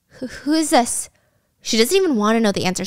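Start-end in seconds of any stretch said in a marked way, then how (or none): 0.1-1.1 slow, higher, hesitant retold fright; freaked out. Woman answering a creepy call, mildly freaked out. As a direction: stammer it like a nervous phone answer. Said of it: "she's looking freaked out".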